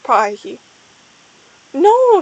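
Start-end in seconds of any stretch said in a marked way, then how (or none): 1.7-2.2 high, loud drawn-out "no"; Starpaw's protesting shout; shouted refusal. A narrator's reading. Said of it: "shouted Starpaw".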